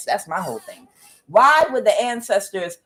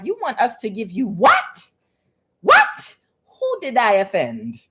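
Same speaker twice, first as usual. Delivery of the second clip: incredulous ancestors; 2 semitones higher than usual. Retold or imitated. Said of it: "The ancestors was like".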